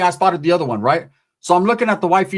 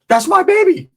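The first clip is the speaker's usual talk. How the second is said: a much higher, louder shout; retold shout; 13 semitones higher, 4 dB louder than usual. Protecting his pregnant girlfriend from his wife, a high shout. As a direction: shout it to claim the baby. Yelling about his unborn child.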